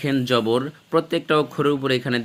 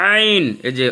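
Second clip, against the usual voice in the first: normal talking voice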